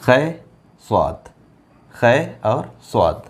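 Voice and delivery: slow, drawn-out letter names; slow spelling. Shown as a demonstration. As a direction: spell each letter slowly, as if dictating. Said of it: "letters spelt out slowly". (धीरे-धीरे अक्षर गिनाना)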